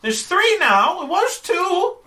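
put-on high, loud indignant old lady; old woman correcting sharply. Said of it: "She kinda fixed her spectacles".

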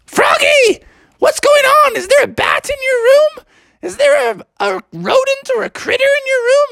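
very high, squawking mother voice; high-pitched, fretful cartoon mom; alarmed mom. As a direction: call upstairs in comic alarm. Alarmed mom calls upstairs to Froggy.

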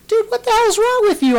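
very high, loud indignant protest; mock offended anger. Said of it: "He was mad. He was like".

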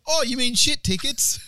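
high, slow mock-Aussie drawl; country Aussie local. Shopkeeper answers about toilet paper, hammed-up country Aussie. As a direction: ham up a broad rural Aussie. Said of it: "I was in country Australia".

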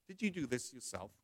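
low, very soft question from authority; quiet, searching teacher's question; hushed questioning. Acted. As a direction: ask gravely, almost under the breath. God questions the student gravely.

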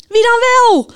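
high, loud impatient shout. A narrator's reading. Knight demands the winner from the radio, shouting. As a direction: yell the question in exasperation. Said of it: "Riep de ridster".

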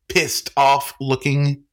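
Pissed slow, leaned on; stress on pissed. A narrator's reading. Grant mocks Charlie's word to the bartender, slow emphasis. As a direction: drag out Pissed off looking. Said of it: "he said, emphasizing the word".

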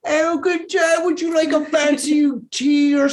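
high, comic British tea offer; hammed-up British host; British accent. Shown as a demonstration. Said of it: "when I do my British accent".

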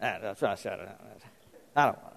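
very low, quiet reluctant mumble; mock grumbling under the breath; grumbling husband. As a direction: mutter it like a husband avoiding a chore. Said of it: "sound like your husbands".

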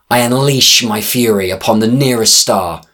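ordinary delivery, nothing marked

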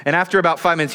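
ordinary talk, nothing marked

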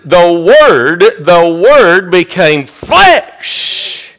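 Southern-pulpit sermon cadence; impassioned preaching. Real, not acted. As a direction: preach it out with ringing conviction, drawing out key words. Preaches at full force.

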